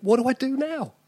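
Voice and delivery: high, bewildered panic; a new father suddenly lost at sea; a new dad bringing his baby home, panicking; panicked new dad. Retold or imitated.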